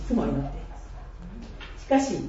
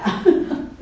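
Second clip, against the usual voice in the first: embarrassed laugh; 6 semitones higher, 6 dB louder than usual. Real, not acted. Embarrassed at being overheard.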